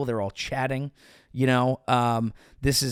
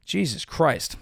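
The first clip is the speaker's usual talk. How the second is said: normal talking voice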